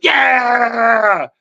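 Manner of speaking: put-on very high, loud childlike cheer; kids cheering. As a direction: shout yeah like a room of thrilled kids.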